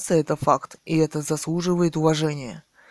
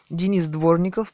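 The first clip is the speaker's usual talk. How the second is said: usual speaking voice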